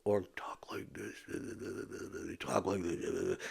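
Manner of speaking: whispered, slow, swallowed mumble; mumbling demo. Shown as a demonstration. Showing how her sons mumble at her, whispered and slow. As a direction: mumble it the way the boys do.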